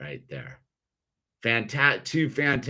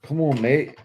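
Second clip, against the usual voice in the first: put-on English accent; 5 semitones higher than usual. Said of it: "Doesn't he have, like, an English accent?".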